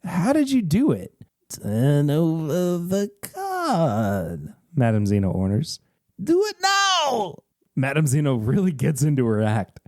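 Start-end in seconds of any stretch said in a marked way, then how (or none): 1.5-4.5 slow, drawn-out fortune teller voice; mysterious fortune teller. Acted. Fortune teller commanding the player, slow and eerie. 6.2-7.4 high, slow shrill-free command in a seer's voice; commanding fortune teller. Acted. Fortune teller orders the player to flip the card, slow and high.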